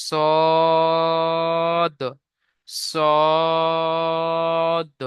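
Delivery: সদ with rounded lips, drawn very long; rounded long sad. Shown as a demonstration. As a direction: round the mouth and stretch সদ. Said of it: "মুখ গোল করে পড়তে হবে". (মুখ গোল করে লম্বা টেনে সদ)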